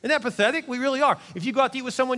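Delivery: ordinary delivery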